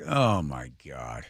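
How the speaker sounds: low, slow mock dismay; retold dismay. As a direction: sink into a quiet groan of disbelief. Dismayed reaction to the reenactment.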